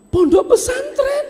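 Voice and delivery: very high, loud, disbelieving squeak; shrieking disbelief; incredulous wife. Acted. Wife hears husband's plan, very high astonished cry.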